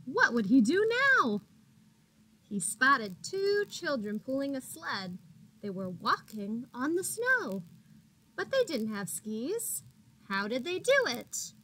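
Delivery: bright, lively picture-book reading; animated storybook narration; reading to children. A narrator's reading. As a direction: read it like storytime for little ones. Reads picture book to kids.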